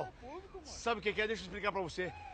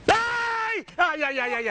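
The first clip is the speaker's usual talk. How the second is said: pain scream; 6 semitones higher, 6 dB louder, much slower than usual. Acted. Prank victim screams in pain.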